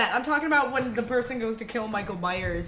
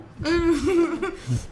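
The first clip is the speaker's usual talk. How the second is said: laughing, pitched up; high laugh; 9 semitones higher than usual. Real, not acted. Laughing at the dog joke.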